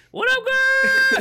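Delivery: very high, loud, drawn-out greeting; dog voice. Retold or imitated. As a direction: coo at the puppy. I have the dog voice now.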